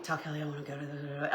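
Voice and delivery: soft, fast, low mumble; swallowed mumbled words; mumbling demo. Shown as a demonstration. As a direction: mutter it into the phone. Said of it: "but I mumble".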